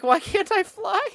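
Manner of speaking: high, loud baffled complaint; puzzled whine. Retold or imitated. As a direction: squeal it in disbelief. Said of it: "I'm like".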